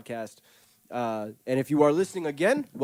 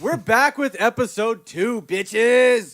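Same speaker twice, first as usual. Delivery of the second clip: hyped announcer; 10 semitones higher, 10 dB louder, much slower than usual. Acted. Podcast host hype.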